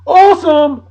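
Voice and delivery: high, loud announcer's burst; big high-energy exclamation; excited cheer. Real, not acted. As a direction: belt it out with gusto.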